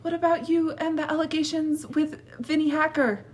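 high, pushy celebrity reporter; paparazzi voice. Pretend. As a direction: call out like paparazzi. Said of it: "a question from one of the TikTok paparazzi".

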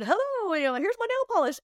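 mock eager interruption. Rookie breaking into the experts' huddle, mock eager. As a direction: pipe up high, imitating a clueless intruder.

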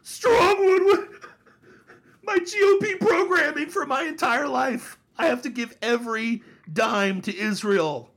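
gasp, then a high, loud sobbing-style rant; hammed-up anguish, pushed very high; mock hysterical wailing. Host mocking lifelong GOP programming, hysterical wail. Mocks a distraught GOP supporter.

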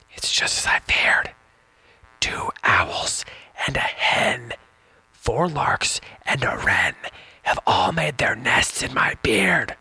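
put-on slow whispered old man's words; whispered fretful old man. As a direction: hush it like a secret. Second reading, quote whispered.

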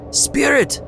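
high, loud call of "Spirit!"; desperate cry. A narrator's reading. Scrooge clutching the ghost's robe, desperate high cry. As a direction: fling the word out in panic. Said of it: "he cried".

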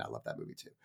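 unvoiced whisper, almost private; hushed confession; whispered aside. Real, not acted. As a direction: breathe it like a secret admission. Aside after saying he'd love a win.

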